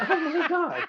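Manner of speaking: high burst of laughter; laughing. Real, not acted. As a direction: laugh it out in disbelief. Reacting to absurd direction.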